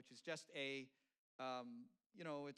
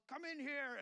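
mock chef's bellow, much higher and louder; yelling; 11 semitones higher, 5 dB louder, slower than usual. TV chef calling the owners in, imitated loud yell. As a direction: bellow it across an empty restaurant. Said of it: "And, and he yells".

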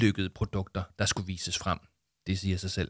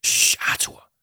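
whispered shush; 5 dB louder, slower than usual. Acted. Jama hushes loud Arthur, whispered shush. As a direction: hush him urgently in a whisper. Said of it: "tyssede Jama".

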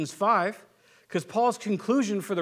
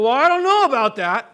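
higher, louder skeptical wife voice; mock a wife questioning aloud; doubting wife; 5 semitones higher, 10 dB louder than usual. Wife doubting husband's prophecy in the gathering, mocking louder.

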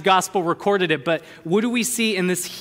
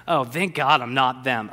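lower, mock self-righteous sigh of relief; smug mock; 5 semitones lower than usual. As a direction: play a smug churchgoer lightly. Mocking self-righteous churchgoer.

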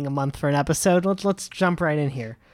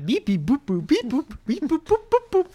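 toy robot voice; 9 semitones higher than usual. Pretend. Electronic segment jingle beeps.

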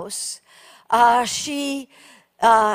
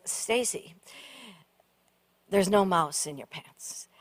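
low, soft, steady reassurance; calm husband; 7 semitones lower, 9 dB quieter than usual. Retold or imitated. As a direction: gently tell her there is nothing there. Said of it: "relatively calmly".

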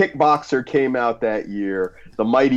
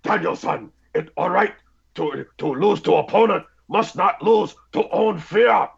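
high, halting Miyagi voice; lightly voiced wise old teacher; Mr. Miyagi impression; 9 semitones higher than usual. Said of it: "He's like".

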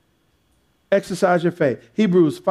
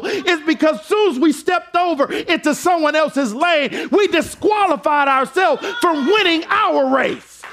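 high, shouting preacher climax; rising pulpit fervor; full-force preaching; 8 semitones higher than usual. Acted. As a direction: preach it hard to the congregation.

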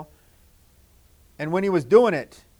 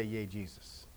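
hushed; 9 semitones lower, 19 dB quieter than usual. Real, not acted. Soft reassurance to children.

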